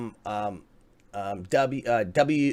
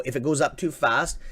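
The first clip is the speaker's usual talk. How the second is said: normal talking voice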